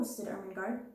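plain reading voice

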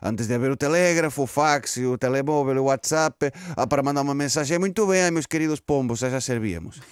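put-on indignant pigeon in Spanish-accented Portuguese; put-on Spanish accent, aggrieved. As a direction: grumble with a comic Spanish accent. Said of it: "estou com sotaque espanhol".

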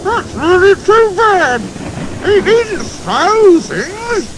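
muffled, slow and high, as if pinned under weight. Acted. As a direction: speak from under a heavy cover, muffled. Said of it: "he exclaimed in a muffled voice".

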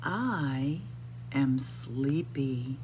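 usual speaking voice

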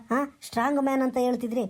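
ordinary talk

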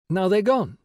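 high, animated schoolmistress building excitement; teacher leading pretend game. A narrator's reading. Teacher conjuring birds for children, animated high voice.

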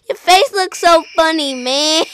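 loud high laughter; laughing. Real, not acted. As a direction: laugh hard between words. Laughing at friend's face.

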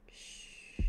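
long, quiet "shh"; soft shush. Real, not acted. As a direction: hush the room gently. Hushes herself for the scene.

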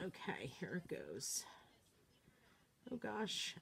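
hushed whisper before the reveal; whispered suspense. Real, not acted. Crafter about to lift the stencil, tense whisper. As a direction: whisper it, holding the breath.

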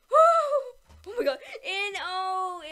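sudden loud cry of shock; shocked shout. Real, not acted. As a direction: yelp in alarm. Nearly losing the round.

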